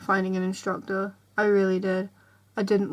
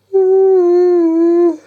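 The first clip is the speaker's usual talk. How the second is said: long, loud, high groan; loud tired groan; 8 semitones higher, 14 dB louder than usual. Real, not acted. Vlogger feeling tired and sickly, long loud groan. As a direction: groan it out at full volume.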